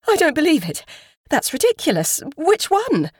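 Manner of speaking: high, agitated woman's protest; shocked disbelief. A narrator's reading. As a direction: refuse to believe it, voice pitched high. Woman shocked by news of body.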